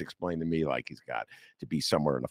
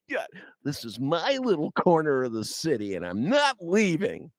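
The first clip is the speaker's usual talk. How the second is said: higher, hammed-up territorial Chicagoan; mock stubborn local; 8 semitones higher than usual. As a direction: play a local who won't budge.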